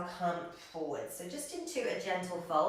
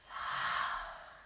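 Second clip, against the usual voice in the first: breathy open-mouthed sigh out; slow demonstrated out-breath; long audible exhale. Shown as a demonstration. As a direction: let a deep breath out through the mouth.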